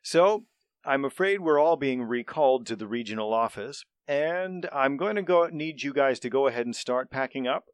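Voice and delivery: loud, formal manager voice; pompous boss speech. Acted. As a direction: address the group like a pompous manager. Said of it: "leader speech".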